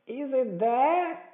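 high, drawn-out roared question; Pew's roar. A narrator's reading. Blind beggar to the man upstairs, demanding roar. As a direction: roar it slowly at a high pitch. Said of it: "roared Pew".

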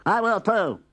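high, eager little pig; a squeaky second piglet. A narrator's reading. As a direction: pipe up like a tiny pig. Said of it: "said the third".

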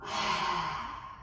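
forceful breathy exhale, tongue out; lion's breath exhale. Shown as a demonstration. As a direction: let it all out like a roaring lion. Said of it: "the full lion's roar".

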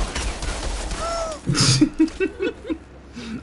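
breathless squealing laugh; high laughing fit. Real, not acted. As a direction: laugh helplessly, high and airy. Laughing at chipmunk voice.

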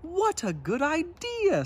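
very high, eager dragon; grateful squeal; Zog delighted. A narrator's reading. Zog thanking the girl, very high. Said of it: "said Zog".

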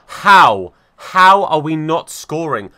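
a loud, slow "How?" of disbelief; exasperated outburst. Real, not acted. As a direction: drag out the frustration at the miss. Another miss, disbelief.

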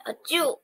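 soft pretend achoo; mock sneeze. Shown as a demonstration. Teacher shows children sneezing, soft mock sneeze. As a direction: fake a little sneeze.

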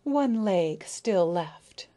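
very slow, unbothered reply. Acted. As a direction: drag it out while eating. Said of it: "replied the dragonfly".

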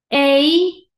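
loud, stretched "Ey"; long A sound. Shown as a demonstration. Teacher drilling long against short, long loud "Ey". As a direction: hold "Ey" long and loud, rising. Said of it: "¿Cuál era el sonido largo?".

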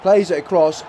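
ordinary talk

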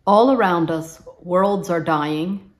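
normal talking voice

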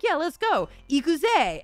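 high, loud anime protagonist shout; earnest determined anime hero. Retold or imitated. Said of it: "You sound like an anime protagonist, like a little boy".

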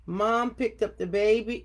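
usual speaking voice

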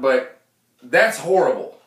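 ordinary talk

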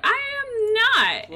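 high, drawn-out mock protest; offended denial. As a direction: stretch it out in slow, high indignation. Acted quarrel, offended denial.

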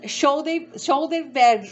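halting try at "shoulder bag" in a Brazilian accent; "bag" as behg, effortful second attempt; struggled pronunciation. Real, not acted. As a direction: attempt "Shoulder" as sho-dey, the L lost. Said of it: "eu não consigo falar o nome".

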